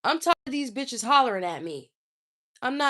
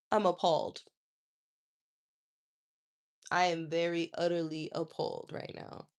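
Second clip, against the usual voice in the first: usual speaking voice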